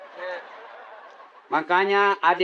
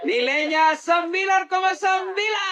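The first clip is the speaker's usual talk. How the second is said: rapturous score; 13 semitones higher than usual. Acted. Mock-judging the mayor's salawat.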